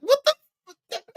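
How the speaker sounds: high-pitched what the; shocked high disbelief. Real, not acted. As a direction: gasp it out in shock. Reacting in disbelief.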